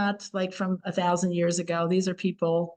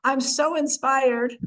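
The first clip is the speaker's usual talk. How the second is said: mock inspired gush; 6 semitones higher, slower than usual. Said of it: "comes up to say".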